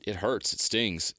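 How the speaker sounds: normal talking voice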